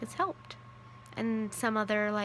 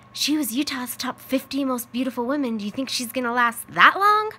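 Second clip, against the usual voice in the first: loud cheeky tease, rising to a near shout; teasing; 4 semitones higher, 8 dB louder than usual. Retold or imitated. Son teasing mom at the kitchen table, loud and cheeky. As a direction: rib her loudly with a grin.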